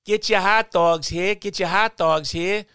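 high, loud hot dog vendor call; stadium vendor hawking. Retold or imitated. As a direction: bark it like a ballpark hawker.

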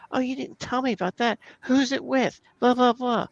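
high, prying wife impression; mock wife voice. Husband mimicking his wife quizzing him, high and nosy.

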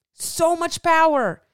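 "so much power" slowed, high and loud; heavy emphasis. Real, not acted. Coach fired up, stressing the scale's power over viewers. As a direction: hammer "so much power" out slowly.

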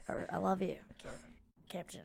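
whispered love confession, hammed up; mock whisper. Munda confessing love to the captain, a hammed-up whisper. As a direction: breathe it like a fake tender confession.

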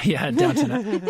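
laughing. Real, not acted. Laughing joke reply.

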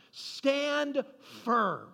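slow-spaced, high emphatic order; battle command. Retold or imitated. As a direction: set each word apart as a rallying command. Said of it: "this is battle language".

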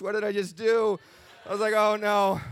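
suddenly realising what he promised, voice jumps up; retold panic. Panic after vowing to quit.

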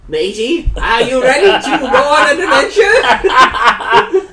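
loud, high, slow mock Australian; bad Australian accent. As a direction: ham up a terrible Australian adventurer, laughing. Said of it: "Every Australian listener just turned off".

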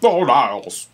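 plummy Frasier impression, mock choked; a strained attempt at Frasier calling Niles; bad Kelsey Grammer. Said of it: "That is not at all how he talks".

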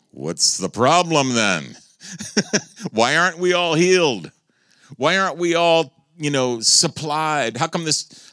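loud, high rhetorical questions; fiery preaching. Acted. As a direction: challenge the church at full force. Preaching at full force.

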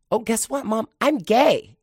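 put-on high, loud, excited little boy; proud child. Little boy proudly telling his mom, high and loud.

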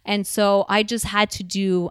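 ordinary talk

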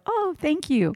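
mock-gracious thanks through a smile; polite smile. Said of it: "you just smile and you just go".

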